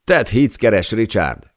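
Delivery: high-pitched call to another desk; calling across. A narrator's reading. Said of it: "kiáltott ekkor át Tony a másik asztaltól". (átkiáltva a másik asztaltól)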